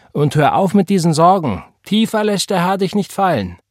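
rousing, insistent reassurance; emphatic exhortation. Acted. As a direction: urge a friend firmly to stop worrying. Florence firmly orders her friend cheered.

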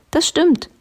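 very high-pitched confirmation; calling princess. A narrator's reading. King's daughter from the tower, very high call. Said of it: "rief die Königstochter".

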